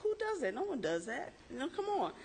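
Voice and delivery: high, teasing disbelief at a colleague; incredulous. Retold or imitated. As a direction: ask it like it's unthinkable. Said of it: "I said".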